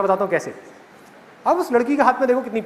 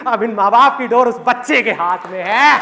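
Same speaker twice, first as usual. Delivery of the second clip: louder, slower, higher punchline; emphatic declaration; 4 semitones higher, 5 dB louder than usual. Real, not acted. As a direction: drive the moral home. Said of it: "speaker's emphatic moral point". (ज़ोर देकर, धीमे और ऊँचे स्वर में)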